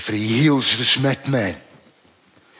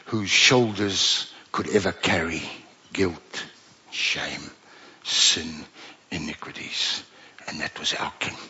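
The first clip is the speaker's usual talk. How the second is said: intense hushed preaching; 7 semitones lower, 7 dB quieter than usual. Real, not acted.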